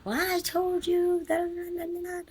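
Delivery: high, drawn-out imitation of a scolding; mock scolding. Woman going in on the speaker's child, imitated scolding. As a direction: mimic someone laying into a child, pitched high and slow. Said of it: "she starts going in on my baby".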